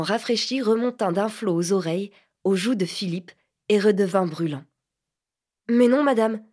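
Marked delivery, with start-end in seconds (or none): 5.7-6.5 louder, sour protest; sour cry. Acted. Embarrassed boy cries back sourly at the lady. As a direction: snap back, stung and indignant. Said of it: "cria-t-il d'un ton aigre".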